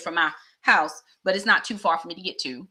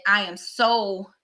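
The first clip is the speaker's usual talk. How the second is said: ordinary talk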